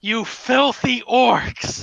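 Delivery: slow, high, loud rage; drawn-out, anguished shout; furious accusation. Acted. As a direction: spit out the insult at full volume. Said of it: "a very angry Gundren".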